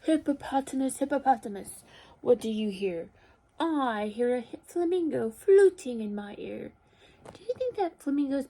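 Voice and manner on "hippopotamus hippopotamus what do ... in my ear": measured, expressive question and answer; picture-book read-aloud. A narrator's reading. Teacher reads animal story to children, expressive storytelling. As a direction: read it out clearly for small children.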